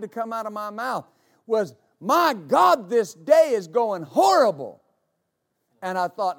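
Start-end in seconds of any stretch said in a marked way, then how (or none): 2.0-4.8 exasperated outburst. Retold or imitated. Preacher retelling the words that nearly slipped out, exasperated outburst. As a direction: blurt it out loudly, punching each key word.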